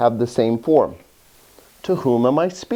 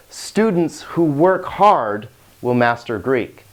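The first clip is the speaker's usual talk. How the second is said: slow demo sentence; slower than usual. Shown as a demonstration. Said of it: "Did you hear it?".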